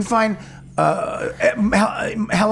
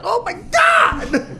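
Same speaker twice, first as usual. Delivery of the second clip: much higher, slow shouted outburst; shocked outcry; slower than usual. Retold or imitated. Reacting to roommate's dog voice with a high shout. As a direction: shriek it in disbelief. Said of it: "I'm like".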